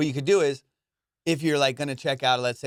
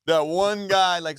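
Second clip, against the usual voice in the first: hyped up; 5 semitones higher, 4 dB louder than usual. Real, not acted. Hyping up friend's deal skills.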